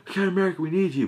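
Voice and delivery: a soldier begging Captain America for help; pleading call. Retold or imitated. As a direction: call out to him like a desperate messenger. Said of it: "like, like".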